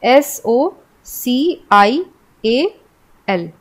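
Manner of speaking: letters spelled one by one, very slowly; spelling out slowly. Shown as a demonstration. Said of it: "जैसे social में क्या है". (धीरे-धीरे अक्षर बोलते हुए)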